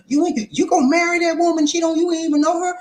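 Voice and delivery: loud, high falsetto objection; high-pitched, incredulous auntie; outraged auntie. Acted. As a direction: object in a scandalized aunt voice.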